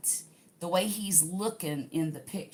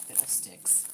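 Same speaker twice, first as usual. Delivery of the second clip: muttered curse; 10 semitones lower, 15 dB quieter than usual. Real, not acted. Mild curse at extra pieces.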